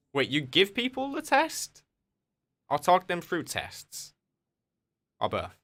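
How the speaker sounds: high, slow incredulous questions; surprised disbelief. Real, not acted. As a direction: pitch it way up in drawn-out disbelief. Reading a surprising chat claim.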